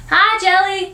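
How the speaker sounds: put-on very high small-child voice; little boy. Little boy greeting the neighbour, eager. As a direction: chirp a hello like a tiny kid.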